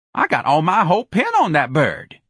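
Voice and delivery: high, lively patter with whoops; exuberant Jones character. A narrator's reading. Jones talking up the act to Lana, exuberant. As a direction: crow about it in a high voice.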